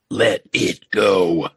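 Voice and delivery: low, very slow movie trainer; deep, deliberate manager impression; Apollo Creed's manager. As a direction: drag each word out low and heavy. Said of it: "Apollo Creed's manager saying".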